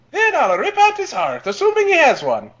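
high, gleeful psycho threat; menacing villain voice. Acted. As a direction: relish every gruesome word. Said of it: "That wasn't bad, [name]".

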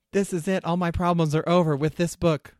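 put-on high, eager young girl; middle school girl voice. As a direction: gush like a hopeful schoolgirl. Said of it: "She's a middle school girl".

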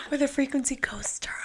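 breathy whisper to friends; stage whisper. Acted. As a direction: whisper it like a secret greeting. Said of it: "a whisper shout out".

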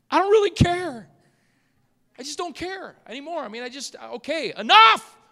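high-pitched, comic fed-up outburst; mock exasperation. Preacher refuses toxic talk, mock exasperated. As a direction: squeal it in playful exasperation, bursting out on the last word.

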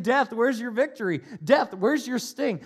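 taunting. Retold or imitated. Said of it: "he is taunting death".

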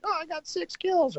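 put-on high, light kid voice; son's voice. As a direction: chirp it like a proud young boy. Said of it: "He's like".

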